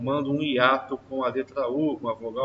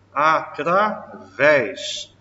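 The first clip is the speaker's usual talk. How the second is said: slow drawn-out word; 5 dB louder, much slower than usual. Shown as a demonstration. Teacher slowly stretches the example word for the class. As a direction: stretch every syllable slowly. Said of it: "teacher drawing out example word".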